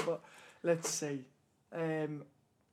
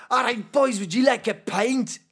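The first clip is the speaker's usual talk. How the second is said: higher mock Scottish; Scottish accent; 4 semitones higher, 3 dB louder than usual. Friend making others guess the country, mock Scottish accent. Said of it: "That's Scottish".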